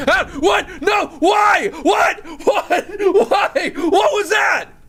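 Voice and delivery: very high, loud panicked shrieks; startled screaming. Real, not acted. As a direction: scream each word in a burst of real fright. Jump scare interrupts the mock complaint.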